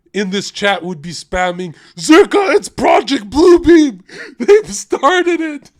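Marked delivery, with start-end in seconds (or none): 2.0-5.7 high, slow, wailing shout; hammed-up hysterical alarm breaking into laughter; mock panic. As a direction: cry out like a panicked conspiracy theorist. Mimics chat panicking at God's arrival.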